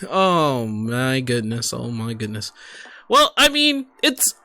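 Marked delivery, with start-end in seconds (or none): none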